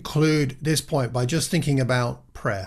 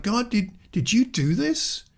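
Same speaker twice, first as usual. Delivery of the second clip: incredulous question to God; 9 semitones higher than usual. Retold or imitated. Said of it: "It's like".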